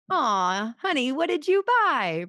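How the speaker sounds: high, sugary honey what did you buy; sweet understanding reply. Shown as a demonstration. Husband answering a big Costco spend, sweetly and high. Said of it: "Do you see the difference?".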